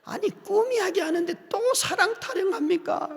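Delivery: half-laughing sobbing complaint; crying protest. Retold or imitated. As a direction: protest through tears. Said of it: "그러면서 막 울었어요".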